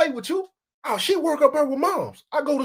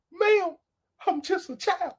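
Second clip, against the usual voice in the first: mock childlike plea; 8 semitones higher, slower than usual. Comic catchphrase refrain.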